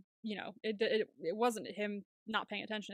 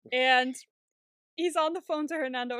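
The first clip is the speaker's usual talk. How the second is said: usual speaking voice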